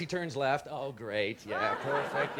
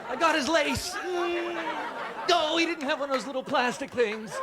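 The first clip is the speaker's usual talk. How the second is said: higher, a touch of strained effort; lightly voiced feeble fighter; 10 semitones higher than usual. Fighting the big guy, a lightly voiced strain. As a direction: grab at his shoelace. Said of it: "I'd be like".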